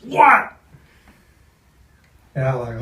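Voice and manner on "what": sharp high shouted what; yelling. Retold or imitated. Rip shouting from his trailer at the knock, angry bark. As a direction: bark it through the trailer door. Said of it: "I hear this".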